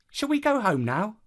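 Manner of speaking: put-on high-pitched child's question; high little voice wanting to leave; child character. A narrator's reading. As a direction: ask it as a young niece, pitched well up. Child character asks to go home.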